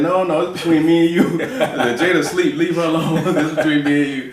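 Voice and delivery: high, loud protest breaking into laughter; retold objection, shouted through laughs; emphatic refusal. Father's amused refusal in retold exchange.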